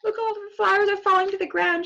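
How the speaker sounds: flustered as the flowers fall; fast, high panic; panicked girl. Acted.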